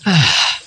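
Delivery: sigh. Acted. Sigh before giving coin.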